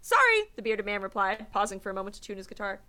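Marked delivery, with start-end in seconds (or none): 0.0-0.5 high, loud chirpy sorry; bright cheery sorry. A narrator's reading. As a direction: toss it off cheerfully. Cheery volunteer brushing off patients.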